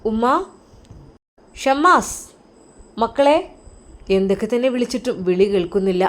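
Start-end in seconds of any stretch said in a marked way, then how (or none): 0.1-3.4 calling out. Acted. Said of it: "വിളിക്കുന്നു".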